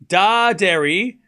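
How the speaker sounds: Daderi as drawn-out DAH-DAIR-ee; pronunciation option. Shown as a demonstration. Streamer offers chat the second option, slow DAH-DAIR-ee for Daderi. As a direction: slowly stretch Daderi, full DAH then DAIR. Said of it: "which one".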